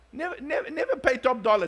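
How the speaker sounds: "Never" repeated high and hammered; emphatic never. Real, not acted. Pastor warning the congregation never to pay top dollar, emphatic. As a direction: hit each "never" harder.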